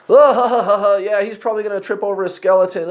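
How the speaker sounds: high, loud imitated group laughter; guffaw, then joke through it; laughing and joking. Teens joking about their friend, loud mock laughter. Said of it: "all laughing and joking around".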